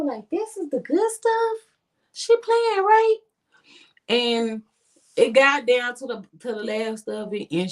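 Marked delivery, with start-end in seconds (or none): 0.3-3.2 much higher, incredulous question; stunned that this is the good stuff; disbelief. Retold or imitated. Friends stunned the weed is weak.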